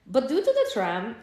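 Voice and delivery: a loud, lightly put-on German accent. Vendor apologizing to Sam, in a German accent. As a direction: beg pardon in a German accent. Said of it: "she said in a thick German accent".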